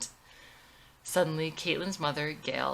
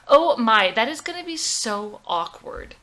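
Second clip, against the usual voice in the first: shocked; 4 semitones higher, 9 dB louder than usual. Acted. Mother reacting to awkward dance.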